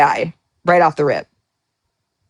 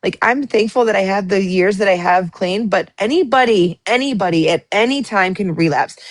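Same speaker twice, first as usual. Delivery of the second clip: normal talking voice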